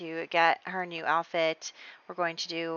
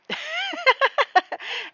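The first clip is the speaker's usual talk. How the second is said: loud, high laugh; real high-pitched laughing fit at oneself; burst of laughter; 8 dB louder than usual. Real, not acted. Laughs at own accidental spoiler.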